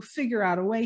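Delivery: ordinary delivery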